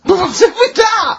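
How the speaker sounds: slow, high, drawn-out shout; incredulous shout. Real, not acted. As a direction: bellow it in disbelief. Mock outrage at Carl's remark.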